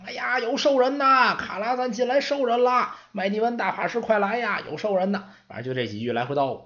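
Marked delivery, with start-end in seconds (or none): 0.0-5.3 high-pitched repeated alarm cries; frantic hollering. Acted. Apprentice calling the archmage through the tower, frantic hollering. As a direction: call out over and over for help. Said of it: "就听卡德加在那儿嚷嚷".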